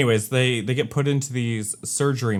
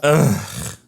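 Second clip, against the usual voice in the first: imitated groan of total exasperation; annoyed groan; 3 dB louder than usual. Host re-enacts the villain's reaction to the helicopter, annoyed groan. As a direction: play someone annoyed and over it. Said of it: "she seems so annoyed and over it".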